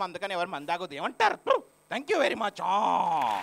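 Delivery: put-on high-pitched comic drunkard; high, wandering drunk-comic voice; MS Narayana. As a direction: imitate a comedian's tipsy film character, stretching the line ends. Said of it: "ఇంత ఆవేశంగా ఉంటే MS Narayana గారు వచ్చారండి".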